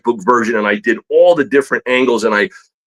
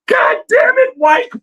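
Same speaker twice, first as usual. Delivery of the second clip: much higher, cracking shout; furious teacher yell. Retold or imitated. Art teacher yelling at a student's work, furious shout. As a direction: explode at the student like an enraged teacher. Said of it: "And he smashes the table".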